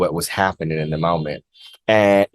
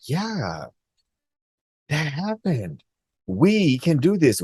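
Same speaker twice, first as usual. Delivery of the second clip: dazed self-talk; 8 semitones higher, slower than usual. Retold or imitated. Dreamer waking up stunned and encouraging herself, airy and higher. Her own dazed inner voice.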